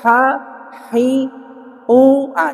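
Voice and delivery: "ha, hi, u, ah" with the h slipping into a glottal stop; h lost to hamzah. Shown as a demonstration. Teacher warning of the error, h turned hamzah. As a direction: raise the pitch and let h drop out of "ha, hi, u, ah". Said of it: "jadi hamzah".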